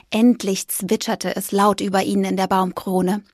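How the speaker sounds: ordinary delivery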